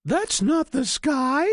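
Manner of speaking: high goose voice, matter-of-fact; sensible goose. Acted. Goose tells the chick it was no sky. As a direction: set the chick straight as the goose. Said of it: "Goosey Lucy said".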